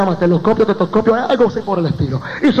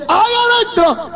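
high, drawn-out mock shout; alarmed cry; 10 semitones higher than usual. Preacher voicing the doctor at the morgue door, high alarmed cry.

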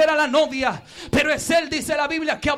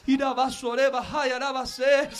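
soft tongues; 4 semitones lower, 6 dB quieter than usual. Real, not acted.

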